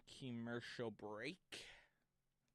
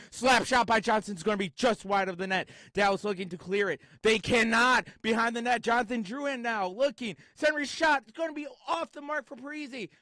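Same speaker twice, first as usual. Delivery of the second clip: higher, louder excited call; rising hype on a scoring chance; 11 semitones higher, 11 dB louder than usual. Acted.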